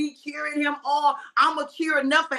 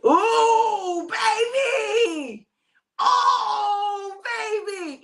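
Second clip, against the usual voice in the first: delighted cry; 9 semitones higher, much slower than usual. Real, not acted. Excited over the new bottle.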